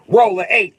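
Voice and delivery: loud high shouted dare; challenging shout. Retold or imitated. Kid daring his friend to roll, loud high shout. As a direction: bark the challenge at him across the dice.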